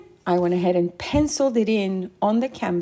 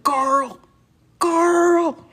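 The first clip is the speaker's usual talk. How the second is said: hammed-up yell of a name, louder on the second; imitating a dad yelling at his son; mock yell; 7 semitones higher, 4 dB louder than usual. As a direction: call the name out, building to a yell. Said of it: "He's yelling at Carl all the time".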